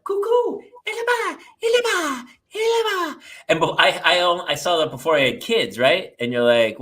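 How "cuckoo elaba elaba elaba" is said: very high sing-song babble; Nell imitation. Imitating Nell from the movie, high sing-song babble. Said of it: "She's like".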